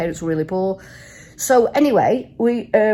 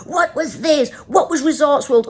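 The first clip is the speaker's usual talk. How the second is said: higher, louder mock quizmaster; firing quiz questions; 5 semitones higher, 5 dB louder than usual. Taxi driver grilling two passengers, firing questions. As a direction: fire questions like an insistent cabbie. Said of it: "firing questions at us".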